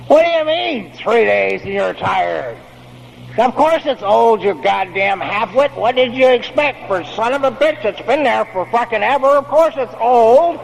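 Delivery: hammed-up furious father; mock angry father rant. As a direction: scold him like a fed-up army dad. Imagined ranting father.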